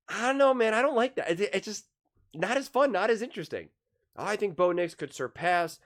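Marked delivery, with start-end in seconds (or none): 0.1-3.7 high-pitched, drawn-up protest; reluctant objection. Real, not acted. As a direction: push back in a high, unconvinced voice. Airs reluctance at the hype.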